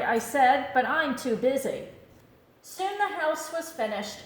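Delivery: ordinary delivery, nothing marked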